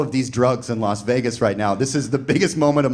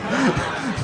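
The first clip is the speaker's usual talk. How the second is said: laugh; 11 semitones higher than usual. Real, not acted. Laughing at the story.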